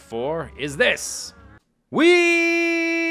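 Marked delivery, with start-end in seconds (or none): 1.9-3.0 long, high, loud "wheee"; high held cry of delight; gleeful whee. Real, not acted. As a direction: squeal one sustained high note of glee. Demonstrating the hang glider with glee.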